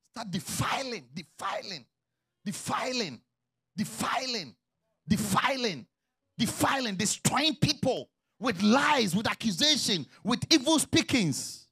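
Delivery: loud, hammering repetition, rising in force; full-force preaching. Real, not acted. Pastor denounces lies and accusation to church, full-force preaching. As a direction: preach it hard, word after drawn-out word.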